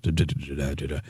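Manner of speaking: soft low droning da da da; lightly mocked monotone murmur; calm mumble. Shown as a demonstration. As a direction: drone softly like a quiet presenter. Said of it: "like this".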